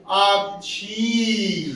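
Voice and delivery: Akshi drawn out very slowly, long final ee; slow, unhurried. Shown as a demonstration. Teacher telling class to take it easy, drawn-out word. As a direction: pause on Akshi and stretch the ending. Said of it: "रुक के बोले आराम से".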